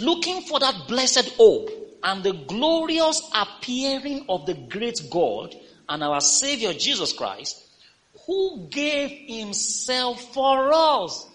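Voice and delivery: slow, high, loud preached reading; lifted scripture proclamation. Acted. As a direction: proclaim each phrase from the pulpit. Reads scripture aloud.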